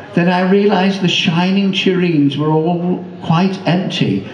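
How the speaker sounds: ordinary delivery, nothing marked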